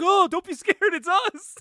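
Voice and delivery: hammed-up Grunge telling her not to be scared; mock reassuring friend.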